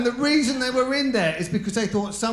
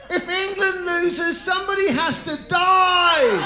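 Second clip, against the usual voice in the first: much higher, loud, laughing shout; fierce football fan; 10 semitones higher, 8 dB louder, slower than usual. Acted. Comedian playing a rabid England fan for the crowd, shouting. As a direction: roar it like a crazed England supporter.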